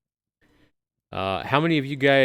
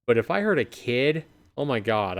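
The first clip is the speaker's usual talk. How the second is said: ordinary talk, nothing marked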